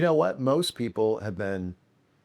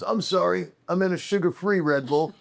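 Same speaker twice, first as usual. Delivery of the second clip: [name]; 9 semitones higher than usual. Retold or imitated. Imitating the guest's fussy correction.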